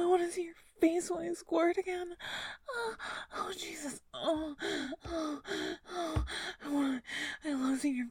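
high, loud, breathless moaning; building to a loud climax; climax moans. Acted. Second climax in erotic roleplay.

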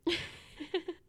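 short breathy giggle; winded laugh. Acted. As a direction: laugh while still recovering breath. Laughs while recovering.

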